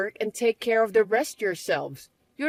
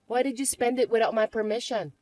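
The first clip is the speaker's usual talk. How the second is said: normal talking voice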